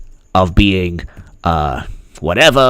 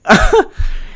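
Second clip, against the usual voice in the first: high giggle. Real, not acted. Laughs again.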